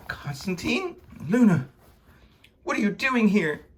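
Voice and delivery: high, startled exclamations; shocked recognition. Acted. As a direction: react in high-pitched disbelief. Surprised meeting at Marguerite's.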